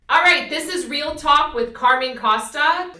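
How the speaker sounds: booming, high-pitched podcast opener; over-the-top loud host announcement; too-loud show intro. Shown as a demonstration. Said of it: "'cause I'm so loud".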